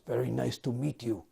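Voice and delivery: imitated Russian-accented greeting. Russian wrestler greets a young magazine writer, in a Russian accent. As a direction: put on a Russian accent, politely. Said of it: "in a Russian accent".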